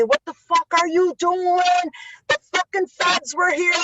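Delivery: dad demanding answers on the phone; angry father. Retold or imitated. Father phoning angry about the feds.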